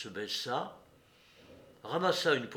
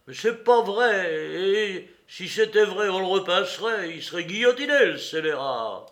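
outraged man; 7 semitones higher, 11 dB louder than usual. A narrator's reading. Courrapier wants the scoundrel guillotined, outraged. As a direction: protest furiously against the lie.

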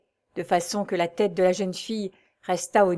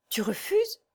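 high-pitched, startled question; taken aback, voice rising sharply; surprised; 12 semitones higher, 4 dB quieter, slower than usual. A narrator's reading. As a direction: leap up in pitch in disbelief. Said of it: "demanda Déborah surprise".

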